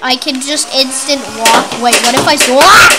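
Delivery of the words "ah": sudden shocked cry; startled yelp. Real, not acted. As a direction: yelp as the game kills you. Dies suddenly in game.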